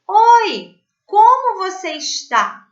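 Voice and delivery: friendly hello shown to the class; greeting demo. Shown as a demonstration. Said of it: "se eu falar assim".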